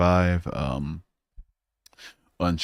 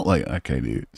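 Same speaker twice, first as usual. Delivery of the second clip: ordinary talk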